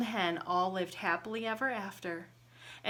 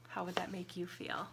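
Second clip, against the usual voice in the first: whisper; 2 semitones lower, 9 dB quieter, faster than usual. Real, not acted. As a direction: lean in and whisper the question. Asking children quietly.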